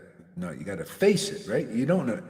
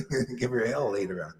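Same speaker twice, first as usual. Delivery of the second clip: ordinary talk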